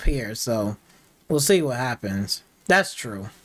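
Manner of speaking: low, laid-back back-and-forth between racers; normal racer chat. Acted. Racers sizing up the foreigner's skills, casual and low-key. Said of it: "that's how people be talking".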